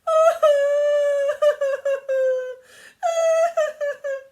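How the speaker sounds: very high, very loud wailing cry; a tree bawling even harder; loud howling wail. A narrator's reading. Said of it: "the more the tree wailed".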